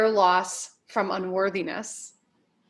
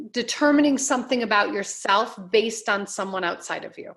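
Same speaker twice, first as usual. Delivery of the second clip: ordinary delivery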